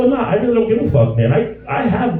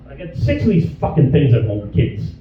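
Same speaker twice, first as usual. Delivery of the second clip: normal talking voice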